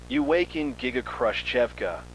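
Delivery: ordinary delivery, nothing marked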